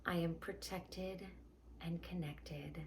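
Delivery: guided meditation. Acted. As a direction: guide them gently into calm.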